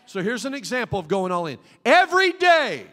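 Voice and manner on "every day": high, loud, ringing cry; loud call. Shown as a demonstration. Preacher calling out "Every day" to the church, loud and high. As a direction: proclaim "Every day" to the crowd. Example of going all in.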